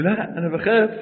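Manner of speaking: mock fear. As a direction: shrink back scared. Scared of the surgery.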